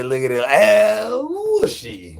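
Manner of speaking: high, slow, squeaky catchphrase; high squeal. Pretend. Streamer to chat, high slow put-on squeal. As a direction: stretch it high and slow like a cartoon.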